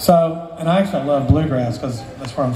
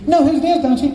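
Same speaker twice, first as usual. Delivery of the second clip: very high, louder caricature; Charlie; 11 semitones higher, 3 dB louder than usual. Acted. Boss pestering the busy mandolin worker, high-pitched. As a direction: needle him in a high-pitched voice. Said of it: "high-pitched voice".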